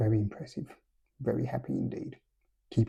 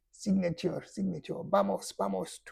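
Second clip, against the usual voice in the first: much higher, hammed-up Spanish accent; mock Spanish accent; 8 semitones higher, 5 dB quieter than usual. Fan's son begging Trent for signature, mock Spanish accent. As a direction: beg in a pitched-up cartoon Spanish accent. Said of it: "if that's a Spanish accent".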